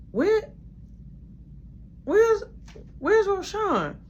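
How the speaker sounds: high, loud searching call; high demanding voice. Retold or imitated. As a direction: demand to know where he went. Girl demanding where he is.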